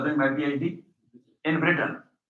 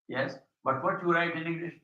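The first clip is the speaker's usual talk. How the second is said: normal talking voice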